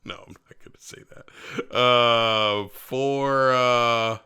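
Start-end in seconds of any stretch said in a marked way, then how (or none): 0.0-1.8 whispered through a laugh, very soft; giggly whisper. Real, not acted. Friend dodging a rude rating joke, whispering. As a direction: hold back the joke under your breath.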